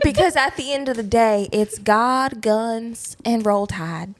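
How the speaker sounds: emphatic Southern drawl; proud Southern belle. Pretend. Southern belle stating what matters at home, Southern accent. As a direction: declare a creed with a Southern twang. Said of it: "this little Republican Southern belle".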